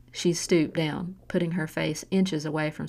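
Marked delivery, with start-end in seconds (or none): none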